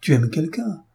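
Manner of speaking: surprised question. A narrator's reading. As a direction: blurt it out in shock. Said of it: "Répéta madame Desmarais".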